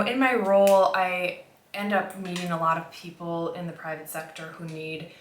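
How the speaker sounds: normal talking voice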